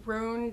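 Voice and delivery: Rune said ROON; long OO in Rune. Shown as a demonstration. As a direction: say Rune to rhyme with moon. Reads name, other says Run.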